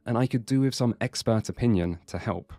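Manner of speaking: usual speaking voice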